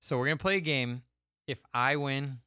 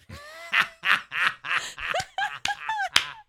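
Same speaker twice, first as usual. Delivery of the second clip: big high burst of laughter; loud laughter; 7 dB louder than usual. Real, not acted. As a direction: laugh loudly at your own joke. Laughs at own joke.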